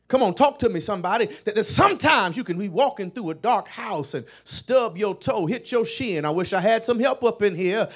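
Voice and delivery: rising, shouted preaching over the organ; full-force preaching. Acted. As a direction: build it up, pleading for light.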